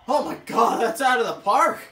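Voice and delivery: loud, thrilled shout at the hit; excited shout. Real, not acted. Gamer shouting at a home run on screen, thrilled yell. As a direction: yell it like the ball just left the park.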